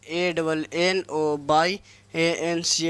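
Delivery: slow dictation of ए एन जी ई आर; spelled out letter by letter. Shown as a demonstration. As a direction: spell ए एन जी ई आर slowly, each letter apart. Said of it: "एनवायंस". (धीरे-धीरे अक्षर-अक्षर बोलना)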